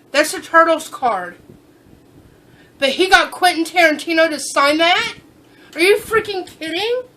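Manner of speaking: can't believe what's in your hands; astonished excitement. Real, not acted. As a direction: voice climbing in real disbelief. Opening a signed card, astonished.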